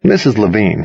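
ordinary delivery, nothing marked

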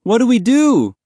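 high, loud desperate question; panicked plea. Acted. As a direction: beg dad for an answer. Distraught son asks father for help.

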